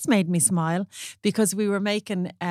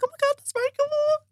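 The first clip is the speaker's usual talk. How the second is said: very high, gushing fan squeal; starstruck squeal. Retold or imitated. Fan after the photo, very high starstruck squeal. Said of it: "And she's like".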